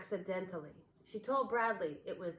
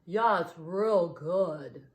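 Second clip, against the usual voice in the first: very slow, louder odd bear voice; 8 dB louder, much slower than usual. A narrator's reading. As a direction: savour it lazily. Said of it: "said Bartholomew".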